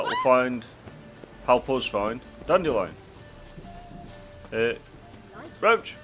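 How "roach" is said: loud high call to the horse. Real, not acted. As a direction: shout across the yard for the horse. Calling the horse.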